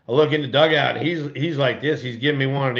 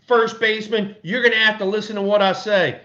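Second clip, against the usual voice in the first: hammed-up stern skipper, pitched way up; stern manager; 9 semitones higher than usual. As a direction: play the boss putting the rookie in his place. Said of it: "so he says".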